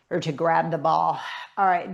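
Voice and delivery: ordinary delivery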